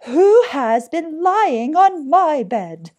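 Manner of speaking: high, very loud, slow cry; middling-sized bear voice. A narrator's reading. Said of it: "cried out in a middling-sized voice".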